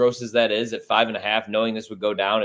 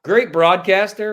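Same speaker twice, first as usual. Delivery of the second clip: sarcastic praise; 8 semitones higher, 4 dB louder, slower than usual. Acted. Sarcastic praise after insult.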